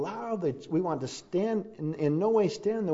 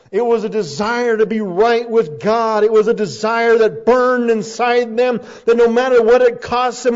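loud, high, impassioned proclamation; full-force preaching; 8 semitones higher, 12 dB louder than usual. Real, not acted. Preacher expounding burning zeal to the congregation, loud and fervent. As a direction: build to a thundering peak of zeal.

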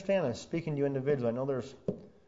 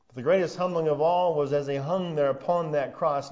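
ordinary talk, nothing marked

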